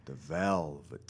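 low, slow ring announcer drawl; deep announcer voice. Shown as a demonstration. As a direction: stretch the name out deep and slow. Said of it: "So you get, like, the deeper, deeper voice".

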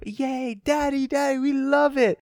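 put-on high squealing child voice; playful impression of kids cheering for a puppy; excited kids. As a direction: imitate little kids begging their dad. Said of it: "your kids are go".

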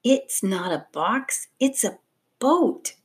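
slow, hushed, rising to boat; disbelief. A narrator's reading. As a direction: whisper in growing amazement. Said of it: "she whispered in disbelief".

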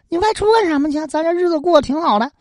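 pleading wife. Acted. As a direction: beg him not to leave. Wife Haogu pleads with husband.

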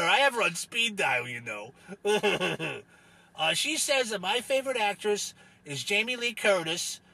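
put-on oddball voice with a chuckle; chuckling Rufus character. Rufus relays daughter's answer to host, chuckling character voice. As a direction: report back, pleased, in character.

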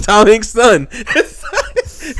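laughing through the words; high, giggling delivery. Real, not acted. Laughing at Tom Hanks' son.

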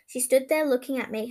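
ordinary delivery

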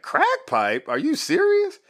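stunned that anyone would ask him that; incredulous. Retold or imitated. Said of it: "In my mind, I'm like".

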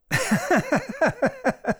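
high-pitched hysterical character laugh. A narrator's reading. Old cod mocking Finney's question, laughing hysterically. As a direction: crack up in a high cackle. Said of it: "He began laughing hysterically".

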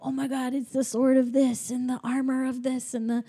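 mock giddy awe; excited. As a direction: gush like a thrilled fanboy. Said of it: "he's so excited to be in there".

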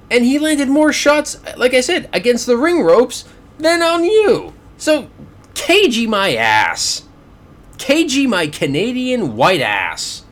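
high-pitched, indignant rebuttal; upset rant. Real, not acted. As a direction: argue back worked up, voice climbing, hitting each point. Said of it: "I'm sorry if I sound so upset".

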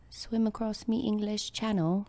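cheeky English parody line; bad English accent. Friend joins the game in a mock English accent. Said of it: "our terrible English accents".

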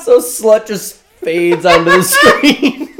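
laughing high through the words. Real, not acted. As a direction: crack up while telling it. Laughing while telling.

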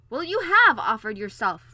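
loud, exasperated comeback; irate royal retort; sharp retort. A narrator's reading. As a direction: throw it back at full volume. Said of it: "retorted the king".

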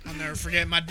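ordinary talk

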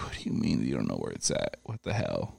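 low, quiet disbelief; incredulous. Retold or imitated. Retelling his reaction to the agent, low and incredulous. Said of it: "I'm like".

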